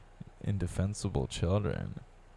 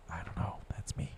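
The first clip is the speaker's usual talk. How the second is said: whispered aside, much softer; 12 dB quieter than usual. Real, not acted. Admitting it to himself in a whisper. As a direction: breathe it to yourself.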